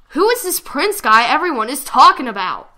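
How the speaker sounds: fed up with hearing about him; exasperated asking; impatient. Acted. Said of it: "he asked impatiently".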